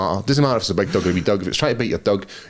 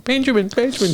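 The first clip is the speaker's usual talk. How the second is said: much higher, a put-on voice calling a runaway dog; woman calling her dog; 14 semitones higher than usual. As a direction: call the little dog back like its fussy owner. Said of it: "the woman's like".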